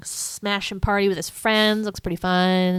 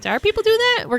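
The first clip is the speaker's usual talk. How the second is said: mock alarmed; 3 dB louder, much faster than usual. Scrooge retold, startled.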